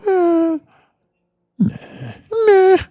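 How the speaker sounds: high, lazy brush-off; dismissive mock. Streamer imitating people who shrug off mentors, high brush-off. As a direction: wave it off like you can't be bothered.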